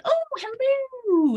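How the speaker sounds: high, drawn-out sing-song hello; playful mock greeting. Therapist greeting the little devil on their shoulder, playfully drawn out. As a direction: greet an unwelcome old acquaintance, high and slow.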